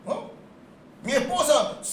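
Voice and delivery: ordinary talk, nothing marked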